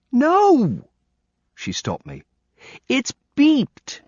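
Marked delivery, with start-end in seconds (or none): 0.1-0.9 put-on high, louder child's correction; child's sharp no. Kelly stopping Nick mid-sentence, sharp child voice. 2.9-4.0 put-on high child voice setting him right; bossy child correction. Kelly correcting Nick's wrong word, bossy child voice.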